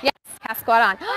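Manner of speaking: ordinary delivery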